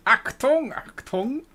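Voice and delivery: shouted warning in a bad German accent; hammy war-film German accent, high and loud. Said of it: "my German accent is terrible".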